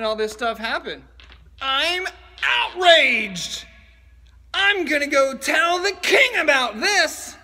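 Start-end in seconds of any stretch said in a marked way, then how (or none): 1.6-7.4 hammed-up outrage. Skit: outraged bystander.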